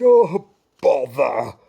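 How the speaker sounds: loud, slow petulant grumble; king grumbling about no butter. Acted. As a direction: sulk like a spoiled king. King's grumble.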